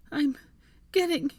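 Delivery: high, tearful, halting promise; choked, high-pitched resolve; stuttering through tears. A narrator's reading. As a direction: break it up as if fighting sobs. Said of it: "she stuttered through her tears".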